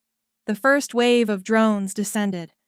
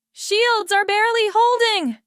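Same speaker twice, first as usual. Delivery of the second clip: very high shouted warning; 13 semitones higher, 3 dB louder than usual. A narrator's reading. Rami shouting warning over the storm, very high. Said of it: "Rami shouted".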